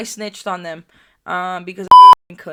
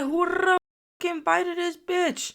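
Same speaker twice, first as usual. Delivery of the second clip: high-pitched, drawn-out mock outrage; acted indignation; 11 semitones higher, slower than usual. Storyteller replays reacting to a girl in the room, indignant. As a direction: replay it as an incredulous, high outburst.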